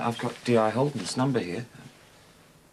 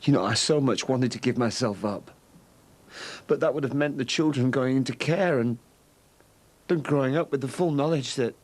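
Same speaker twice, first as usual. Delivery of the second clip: ordinary talk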